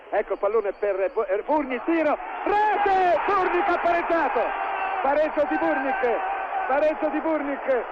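excited equalizer call, slow stretched rete; goal commentary. Acted. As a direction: shout the equalizer, drawing it out.

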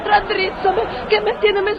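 high, melodramatic entreaty; swooning plea. Acted. Portiera swooning onto the engineer, high melodramatic plea. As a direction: plead high as if about to collapse.

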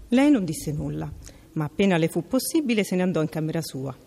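ordinary delivery, nothing marked

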